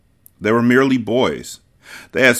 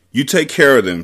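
higher, mildly impassioned plea; passionate; 5 semitones higher, 4 dB louder than usual. A narrator's reading. Aunt charges the woman with her boys, passionate. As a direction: press it home with some passion. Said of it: "she said passionately".

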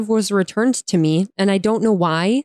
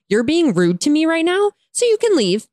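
higher-pitched mock of a rude worker; rude dismissal; 7 semitones higher than usual. Drive-through worker sending a customer away, mock rude. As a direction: imitate a curt brush-off, pitched up high. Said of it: "just being, like, rude".

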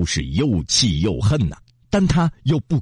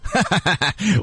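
falling villain chuckle; a dark monster laugh; evil demon laugh; 9 semitones higher than usual. A narrator's reading. Demon laughs before telling his story, falling chuckle.

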